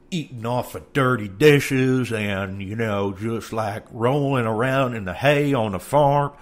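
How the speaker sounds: loud, high Southern drawl; hammed-up Southern farm-country accent. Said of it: "just went into a Southern accent".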